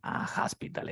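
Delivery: "hospital" whispered with a tapped t; whispered demo. Shown as a demonstration. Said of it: "Es algo como así, mira". ("hospital" susurrado)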